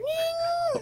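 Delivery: tiny high squeaky "Nee"; squeak. Retold or imitated. Teasing co-host about his squeak, high squeaky mimic. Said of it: "a big squeak when you went".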